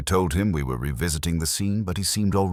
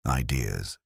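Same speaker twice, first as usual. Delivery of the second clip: the organist dodging the question, vague and soft; 5 semitones lower, 4 dB quieter than usual. Acted. Organist evading the detective's question, said vaguely and low. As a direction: lower and softer, trailing off vaguely. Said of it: "he said vaguely".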